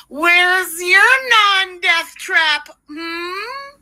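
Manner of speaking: slow, drawn-out, rising taunt; mocking taunt. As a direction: needle him, stretching every word. Taunting the man about his car.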